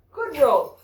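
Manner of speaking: high, loud dog praise; gushing praise for a good fetch; excited praise. Real, not acted.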